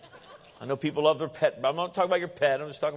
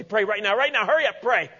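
high, loud hurried burst; mock urgency; 7 semitones higher, 5 dB louder than usual. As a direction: rush everyone into it, hammed up. Acts someone rushing to pray while worthy.

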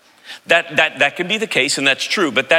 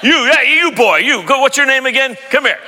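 loud, high, flustered summons; imitating an exasperated father; dad calling out; 9 semitones higher, 4 dB louder than usual. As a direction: fire it off at a boy whose name escapes you. Acting dad calling his boys.

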